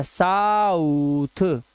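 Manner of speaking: साउथ stretched slowly, "saaa-uuu-th"; sounding out. Shown as a demonstration. Teacher mimics the learner assembling South, sounding out. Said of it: "ये, ये कर देगा".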